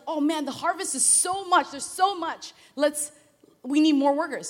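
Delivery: thrilled by the huge harvest; excited farmer. Retold or imitated. Farm owner excited about the harvest, loud and high. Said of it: "then he's like".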